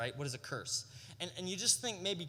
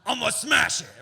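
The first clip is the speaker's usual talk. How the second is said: mock threat; 6 semitones lower, 7 dB louder than usual. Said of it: "get her a voice message".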